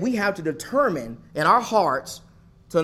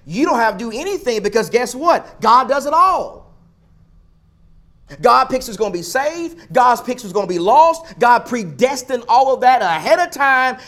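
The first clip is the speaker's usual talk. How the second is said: higher, louder, emphatic voicing of others' belief; imitated believers' claim; 4 semitones higher, 5 dB louder than usual. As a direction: push each point up high, as someone else's argument. Voicing other people's belief in predestination.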